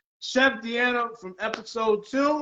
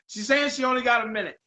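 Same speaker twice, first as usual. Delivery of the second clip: ordinary talk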